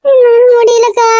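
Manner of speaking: slow, sobbing words of a broken mother; crying. Acted. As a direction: let the words break into tears. Mother weeping over son.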